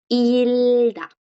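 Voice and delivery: "ilda" with a long held "il" and no G; slow teacher's model of "ilda"; silent G. Shown as a demonstration. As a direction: stretch "ilda" slowly, leaving the G out. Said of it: "la G no suena".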